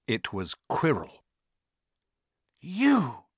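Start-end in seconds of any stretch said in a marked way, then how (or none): 2.6-3.2 high, startled gasp of a word. A narrator's reading. Harry finds Quirrell waiting, gasped. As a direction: gasp it out in shock. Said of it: "gasped Harry".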